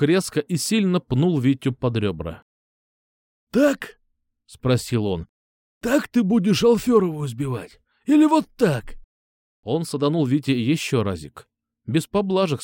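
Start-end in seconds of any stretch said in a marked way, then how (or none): 3.5-9.0 mocking taunt. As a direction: taunt him while kicking. Mocking while kicking him.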